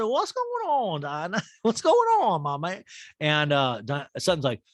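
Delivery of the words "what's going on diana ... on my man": very high, chirpy mock greeting; Sutton impression. Host imitating Sutton welcoming Diana, very high and chirpy. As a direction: squeal it like an overly friendly hostess. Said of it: "I'll say, I'll say".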